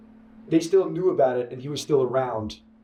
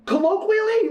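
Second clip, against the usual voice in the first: incredulous; 4 dB louder than usual. Real, not acted. Incredulous follow-up question.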